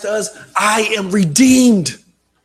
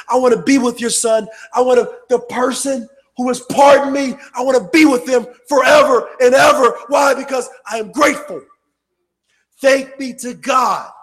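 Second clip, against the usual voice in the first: preaching at full force; 2 semitones higher, 4 dB louder than usual. Acted. Preacher warning the congregation about Satan, loud fiery preaching. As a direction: thunder it from the pulpit.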